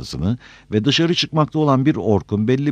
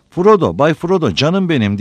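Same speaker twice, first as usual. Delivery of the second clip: higher, louder, mildly tearful call; tearful cry; 7 semitones higher, 6 dB louder than usual. A narrator's reading. Sam finds Frodo lying hurt, lightly tearful outcry. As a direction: cry out the beloved name, a touch of weeping. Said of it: "diye ağlamaya başladı Sam".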